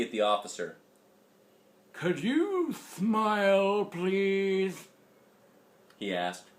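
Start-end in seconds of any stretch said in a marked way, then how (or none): 1.9-4.9 put-on high, slow old man request; suspicious grandpa. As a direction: ask slowly like a suspicious grandpa. Grandpa voiced.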